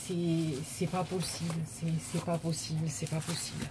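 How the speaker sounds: soft, stunned repeat; quiet disbelief. Real, not acted. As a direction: murmur it as if you can't believe it. Overwhelmed by full parcel.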